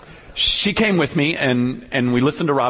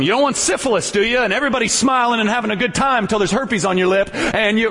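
much higher mock preacher, sing-song warning; fear-mongering preacher; 9 semitones higher than usual. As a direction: ham up a scare-tactic youth preacher. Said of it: "It, it was fear-mongering".

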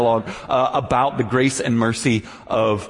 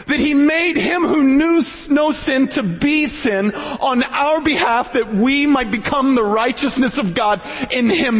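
impassioned preaching; 13 semitones higher, slower than usual. Acted. Preaching the gospel passionately.

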